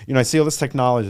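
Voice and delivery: usual speaking voice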